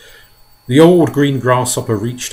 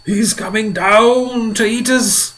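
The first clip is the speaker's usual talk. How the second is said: high, loud, slow terrified wail; wailing grasshopper; 11 semitones higher, 6 dB louder, slower than usual. A narrator's reading. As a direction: wail it out in panic. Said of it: "wailed the old green grasshopper".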